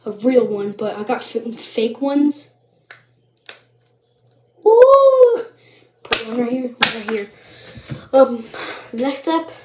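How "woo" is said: loud, high drawn-out "woo". Real, not acted. Vlogger back with fake candles, high woo. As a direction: let out a long, high whoop. Playful cheer after returning with candles.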